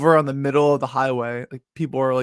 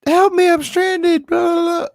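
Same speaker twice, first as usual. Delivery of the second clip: mock helpless plea; 4 dB louder than usual. Calling triple A from the highway, mock high pleading. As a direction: squeak it out like a stranded damsel. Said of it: "and I was like".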